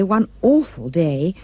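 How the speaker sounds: ordinary delivery, nothing marked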